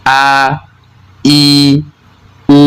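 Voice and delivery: short vowels begun with hamzah, vocal cords open. Shown as a demonstration. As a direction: say a, i, u as separate open hamzah sounds. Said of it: "ketika hamzah berharokat".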